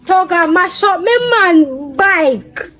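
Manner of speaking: high, slow and loud accusation; loud complaint. Real, not acted. Telling a stranger Toga wrecked the bike, loudly. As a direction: stretch it out, loud and indignant. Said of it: "Why you talk so loud?".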